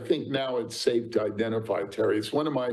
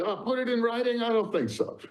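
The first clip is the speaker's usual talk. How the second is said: mock disbelief; 9 semitones higher than usual. Mocking the old memo rule.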